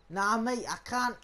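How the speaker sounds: ordinary delivery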